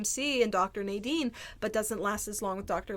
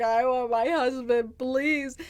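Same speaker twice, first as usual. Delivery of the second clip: slow, tearful mock sob; imitating tearful plea; 4 semitones higher, 4 dB louder, much slower than usual. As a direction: plead like a tearful patient. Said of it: "because I'm bawling".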